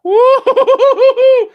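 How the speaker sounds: very high, loud, drawn-out laughing whoop; wild whooping cheer. Real, not acted. Carried away at the end of his speech, ecstatic whoop. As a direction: let it all out in an ecstatic whoop. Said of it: "Sorry, I blacked out there for a moment".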